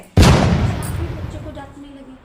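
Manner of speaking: a sudden loud, slow reproach; loud rebuke. Acted. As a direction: scold them for scolding the child. Scolding another adult.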